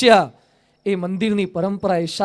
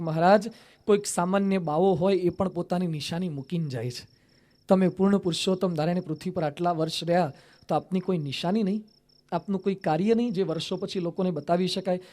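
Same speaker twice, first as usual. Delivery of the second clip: softly pleading, tearful; 2 semitones lower, 7 dB quieter than usual. Acted. Weeping Swami pleads with dying Maharaj.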